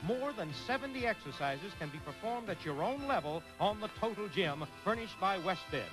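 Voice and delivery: smooth prize announcer voice; announcer read. Acted. Game show prize announcer read.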